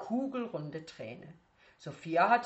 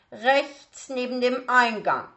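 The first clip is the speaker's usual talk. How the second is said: slow, loud, higher call; calling after him; 7 semitones higher, 9 dB louder than usual. Acted. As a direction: call the directions down the hall. Teacher calls directions after him.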